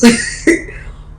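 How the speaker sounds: a quick real laugh breaking in; short laugh. Real, not acted. Dad warns of lawsuits, she laughs briefly. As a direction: laugh at the idea of millions.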